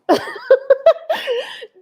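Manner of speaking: long high laugh through tears; high tearful laugh. Real, not acted. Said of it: "I'm tripping".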